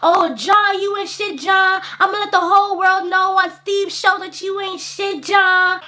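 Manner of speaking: high-pitched recorded-message tirade; imitating an aggrieved guest's message; scornful rant. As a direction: declare it high, drawing out his name.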